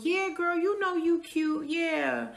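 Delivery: put-on high, light gushing girl talk; mock girlfriends. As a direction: gush along with the group. Women in group agreeing.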